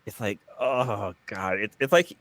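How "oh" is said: normal talking voice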